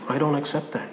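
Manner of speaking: low and soft refusal; quietly firm, as the Lord; the Lord speaking. Acted. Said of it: "the Lord says to you".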